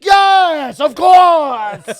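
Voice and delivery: high, shouted mock elation; hammed-up delighted shout; ecstatic acceptance. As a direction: yell it like an overjoyed customer. Retold diner ecstatic at another Coke.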